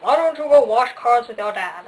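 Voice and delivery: mock mom asking loudly; mom's voice. Daughter imitating mom suggesting chores, loud. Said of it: "my mom was like".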